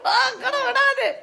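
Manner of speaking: squeaky high playful voice; high-pitched laughing tease; high teasing voice. Acted.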